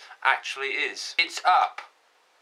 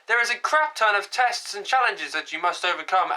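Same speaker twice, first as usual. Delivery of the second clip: usual speaking voice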